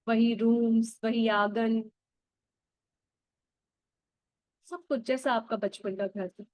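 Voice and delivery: put-on calm guide drawing the listener back to childhood; guided meditation. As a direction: lead them gently into the memory. Guides inner-child visualization.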